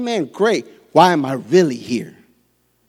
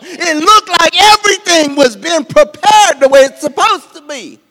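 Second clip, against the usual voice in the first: preaching at full force; 14 semitones higher, 9 dB louder than usual. Real, not acted. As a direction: drive each "all the" harder.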